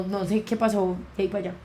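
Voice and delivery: ordinary talk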